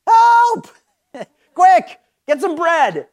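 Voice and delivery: high, loud comic cry for help; mock yell. Husband running home to his wife, mock-urgent yell. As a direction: yell it in a playful panic, easing off. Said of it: "run home to your wife and yell".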